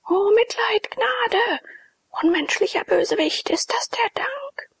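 high, whispered begging of a prisoner left to starve; desperate plea. Acted. Walled-in priest begs his jailer for mercy, high whispered plea. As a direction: beg for mercy, then turn bitter.

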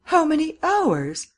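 very high, slow, loud question; acted high-pitched dismay; alarmed cry. A narrator's reading. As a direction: draw it out high in disbelief. Miss Cummins keeps crying in alarm.

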